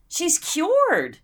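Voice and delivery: high, laughing through the punchline; amused repeat of her own joke. Retold or imitated. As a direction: giggle the words out.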